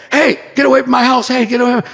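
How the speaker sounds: high, loud mock yell of an angry lady; a neighbor lady yelling at a stranger in her yard, loud and high. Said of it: "I hear some lady go".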